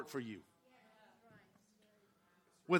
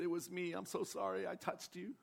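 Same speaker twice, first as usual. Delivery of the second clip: soft, shy confession; timid apology; 11 dB quieter than usual. Retold or imitated. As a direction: admit it quietly, ashamed. Woman confessing softly.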